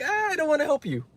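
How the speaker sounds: lightly hammed-up brush-off; snooty Parisian. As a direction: sniff it off like a rude Parisian. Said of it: "they're like".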